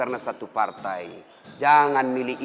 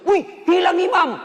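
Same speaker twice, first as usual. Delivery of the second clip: very high, loud, drawn-out cry; mock alarmed holler; imitated startled yell; 9 dB louder than usual. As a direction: yell it slowly across the room. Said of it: "Langsung teriak".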